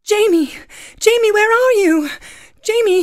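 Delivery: high, loud dramatic call. Acted. Claire calls to Jamie across the Highlands, calling out. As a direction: cry out for a lost lover. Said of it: "we wrote this sketch based on Outlander".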